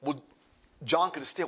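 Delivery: ordinary delivery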